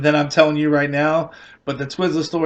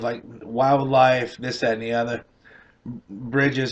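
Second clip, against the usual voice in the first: ordinary delivery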